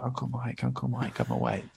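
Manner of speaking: a gentle hint of a little girl calling at the door; sweet little girl. Niece waking him before dawn.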